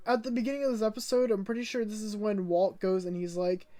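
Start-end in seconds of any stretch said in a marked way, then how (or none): none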